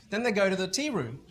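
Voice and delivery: usual speaking voice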